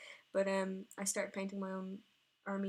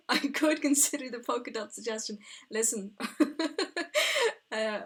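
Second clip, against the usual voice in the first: laughing; 4 semitones higher, 10 dB louder, slower than usual. Real, not acted. Laughing about the polka dot suggestion.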